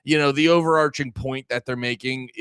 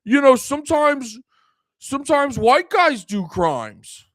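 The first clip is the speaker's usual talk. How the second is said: much higher and louder mock voice, slowing at the end; mocking Tim Walz; 11 semitones higher, 7 dB louder than usual. Mocking the politician Tim Walz, hammed-up whiny voice. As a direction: ham up a whiny, preachy politician. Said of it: "he's essentially being like".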